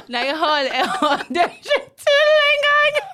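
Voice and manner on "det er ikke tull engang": high, loud laughing protest; laughing shriek. Real, not acted. MC laughing at the Hannah Montana comparison, high and loud. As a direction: squeal it through laughter. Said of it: "laughing disbelief".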